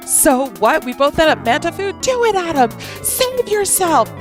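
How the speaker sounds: high, pressing plea; urgent pleading voice. Acted. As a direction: insist he save himself. Delphinius insists.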